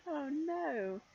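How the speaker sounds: ordinary delivery, nothing marked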